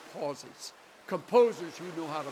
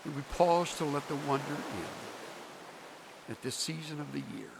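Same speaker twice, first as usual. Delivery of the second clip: prayer; 6 dB quieter than usual. Acted. Preacher praying for the congregation, soft hushed prayer. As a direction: pray gently to close.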